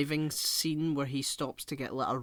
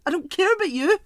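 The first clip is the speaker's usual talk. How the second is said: exasperated rant; 10 dB louder, faster than usual. Real, not acted. Ranting about an unknown character.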